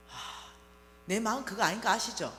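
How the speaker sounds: normal talking voice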